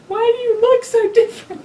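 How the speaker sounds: put-on high, laughing child voice; a laughing imitation of a confused child; child's question. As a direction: ask it like a bewildered little kid. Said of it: "Granny just has teeth".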